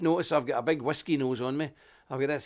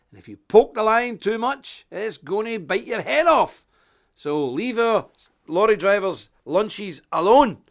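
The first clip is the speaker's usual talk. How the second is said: loud, higher, slow threat; defiant warning; 7 semitones higher, 9 dB louder than usual. Acted. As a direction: roar it like the lion. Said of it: "I will say this to them".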